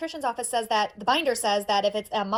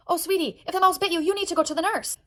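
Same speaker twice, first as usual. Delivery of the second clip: concerned sweetness; 6 semitones higher than usual. Acted. Teacher to bitten girl, concerned sweetness. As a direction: fuss gently over a little one.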